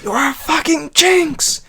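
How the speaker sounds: high, drawn-out mock outburst; acted film outburst. Acts out a film line.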